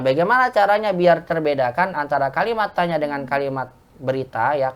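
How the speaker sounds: ordinary talk, nothing marked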